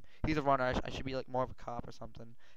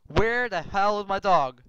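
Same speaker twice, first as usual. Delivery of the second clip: louder, slow and higher, calling out in alarm; frantic shout; 7 semitones higher, 12 dB louder, slower than usual. Real, not acted. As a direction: yell the question out, drawn out and desperate. Dog missing after attack.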